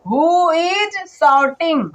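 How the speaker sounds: stretched word by word for copying; very slow dictation. Shown as a demonstration. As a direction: spell it out in the air, very slowly. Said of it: "teacher dictates slowly". (बहुत धीरे, शब्द खींचकर लिखवाना)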